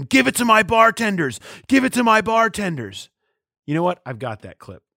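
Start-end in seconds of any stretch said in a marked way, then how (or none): 0.0-3.1 high-pitched mock yell at the coach; mimicked yell. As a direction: imitate a bar emcee yelling, pitched way up. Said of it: "you yell at him".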